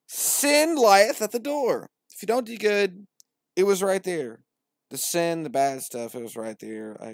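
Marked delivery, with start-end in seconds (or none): 0.1-1.9 much higher, louder, slow proclamation; grand verse. Acted. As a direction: boom the warning. Verse read out.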